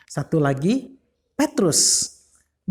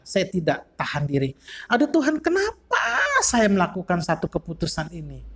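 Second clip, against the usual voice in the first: anguished lament. Acted. Preacher voicing a man regretting anger, ruin and adultery, anguished. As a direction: wring your hands over your failures.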